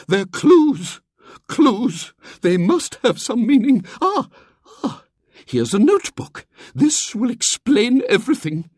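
loud, high eager exclaiming; excited triumph. A narrator's reading. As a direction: pounce on the clues gleefully. Miss Hatherley excited by discovery.

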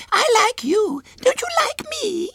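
put-on very high, loud childish pleading; tearful little girl. A narrator's reading. Small girl begs William to like her, tearful. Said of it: "Her blue eyes filled slowly with tears".

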